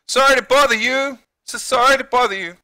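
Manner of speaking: high, loud, hammed-up sorry; flustered apology. Acted apology to the doctor.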